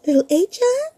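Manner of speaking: high loud worried call; worried mama call. Acted. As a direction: call out in alarm. Mama calls again.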